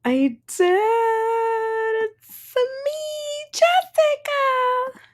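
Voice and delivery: high, slow sing-song hello; sing-song greeting. Real, not acted. Greeting her live viewers, high and drawn out. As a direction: stretch it out brightly, way up high.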